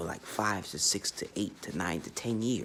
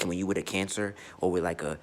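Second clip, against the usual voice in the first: ordinary talk, nothing marked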